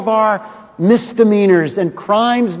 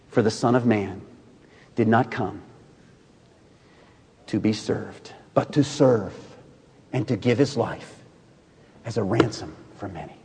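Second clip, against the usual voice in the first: solemn hush; 10 semitones lower, 11 dB quieter than usual. Acted. Climactic quote read solemnly.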